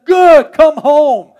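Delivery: slow, high, booming welcome shout; loving father calling. Acted. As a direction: call the child home at full voice. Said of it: "by a loving father who said".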